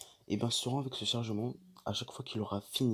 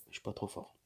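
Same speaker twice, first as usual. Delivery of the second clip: ordinary delivery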